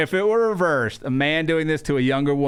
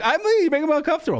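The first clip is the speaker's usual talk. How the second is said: much higher, panicky voice; a hammed-up high I'm leaving; mock alarmed exit; 12 semitones higher, much faster than usual. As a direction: flee in a high squeak. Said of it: "He's like".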